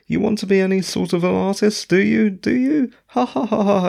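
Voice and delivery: high, fast sneering taunt with a laugh; obnoxious taunt. Acted. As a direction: needle the listener, obnoxiously. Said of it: "quite obnoxiously".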